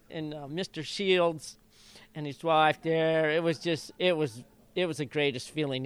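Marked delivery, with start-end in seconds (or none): none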